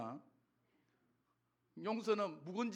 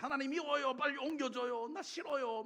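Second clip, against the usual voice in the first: retold whiny complaint, pitched high; mock petulant plea to God; resentful prayer; 7 semitones higher than usual. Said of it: "밉다고 기도했어요".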